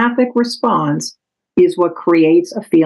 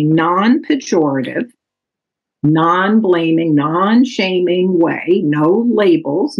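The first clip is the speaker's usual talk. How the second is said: deliberate slow listing; slower than usual. Real, not acted. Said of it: "slowed listing of key terms".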